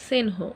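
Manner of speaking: "সেনহো" with a vowel before the n, se-n-ho, no sn cluster; childish mispronunciation. Retold or imitated. Students singing the anthem mispronounce the word, se-n-ho. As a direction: mimic the students saying "সেনহো" for স্নেহ. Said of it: "অনেক স্টুডেন্টরাই বলতো".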